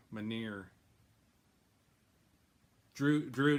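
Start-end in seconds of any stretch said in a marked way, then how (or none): 0.1-0.6 "Mjolnir" cut down to "ma-NEER"; botched pronunciation. Real, not acted. Host tries the hammer's name, "Mjolnir" shortened to "ma-NEER". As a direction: drop the middle of "Mjolnir", saying ma-neer. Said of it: "do you know how to say that?".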